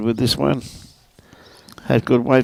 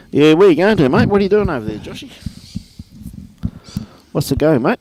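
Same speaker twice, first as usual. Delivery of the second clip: calling out; 6 semitones higher than usual. Real, not acted. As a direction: call out to a mate far off. Calling out to Joshy across green.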